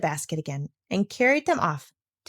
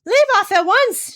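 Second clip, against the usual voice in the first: high shrill-pitched scream, much louder; 14 semitones higher, 6 dB louder than usual. A narrator's reading. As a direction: scream it over the organ music. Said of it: "she screamed".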